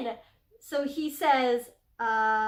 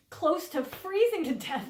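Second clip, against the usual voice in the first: usual speaking voice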